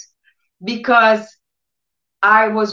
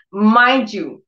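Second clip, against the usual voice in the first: normal talking voice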